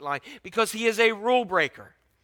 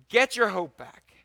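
"Get your hope back" louder and higher, punching Get; rallying call; 5 semitones higher, 7 dB louder than usual. Acted. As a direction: lift it into a rousing exhortation.

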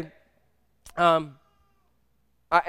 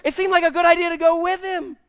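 high-pitched protest; 8 semitones higher than usual. Retold or imitated. Father protesting amid retold chaos.

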